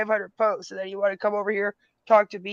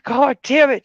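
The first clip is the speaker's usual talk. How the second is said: loud exasperated curse, pitched up; angry outburst; 7 semitones higher, 9 dB louder than usual. Real, not acted. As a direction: blow up at the troll. Ranting at a troll.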